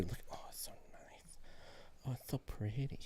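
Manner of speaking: hushed, admiring whisper. Real, not acted. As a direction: breathe it out in awe. Admiring the new set quietly.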